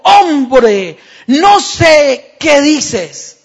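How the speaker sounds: loud, very slow shouted denial. Acted. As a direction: shout it out, exasperated. Peter's third denial.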